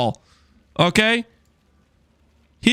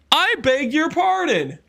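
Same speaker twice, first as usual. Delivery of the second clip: higher, slow, offended "I beg your pardon"; indignant; 8 semitones higher than usual. Real, not acted. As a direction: stretch it out like you can't believe what you heard.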